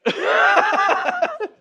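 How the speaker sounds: high, laughing horror; mock horror. Real, not acted. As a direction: react like it's a disaster. Watching the bill fall apart.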